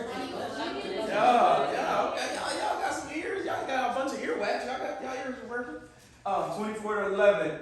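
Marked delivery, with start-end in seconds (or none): 0.0-5.7 laughing through the words, pitched up; real amused laughter. Real, not acted. Laughing, teasing the kids.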